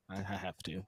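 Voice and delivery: hammed-up hushed low apology; mock reluctant Jericho. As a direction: murmur a put-on sorry excuse. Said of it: "And he's like".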